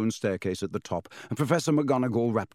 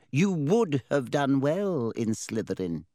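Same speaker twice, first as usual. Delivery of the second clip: slow, ominous hat voice; deliberate knowing hat; talking hat; 4 semitones higher, slower than usual. Acted. Sorting Hat verdict.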